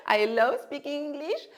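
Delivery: laughing loudly through the words. Real, not acted. Teacher joking about loving English, laughing. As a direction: laugh as you confess it.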